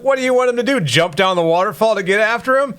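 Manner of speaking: sarcastic high disbelief; incredulous mockery. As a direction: toss it back in mocking disbelief. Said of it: "I'm like".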